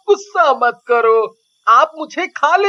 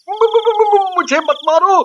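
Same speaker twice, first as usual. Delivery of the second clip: high, panicky stutter begging for mercy; frightened stammer; 3 semitones higher than usual. Acted. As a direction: trip over the first syllable, pitched up in fear. Chilli begs not to be killed. (डर से हकलाते हुए गिड़गिड़ाना)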